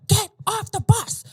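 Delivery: high, loud urgent command; commanding inner voice. Retold or imitated. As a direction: shout it like a sudden voice in the head. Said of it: "this voice in my head saying".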